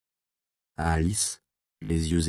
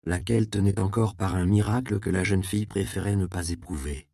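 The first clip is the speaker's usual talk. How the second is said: plain reading voice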